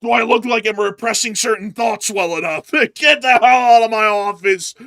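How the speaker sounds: loud hammed-up doctor; mock doctor voice. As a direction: bark it like a fed-up doctor. Mock patient then doctor.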